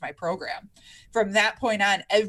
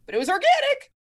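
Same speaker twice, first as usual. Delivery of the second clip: mock-virtuous high; 6 dB louder, faster than usual. Real, not acted. Sarcastic aside about cereal.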